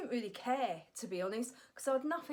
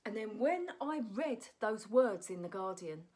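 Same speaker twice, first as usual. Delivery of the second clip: usual speaking voice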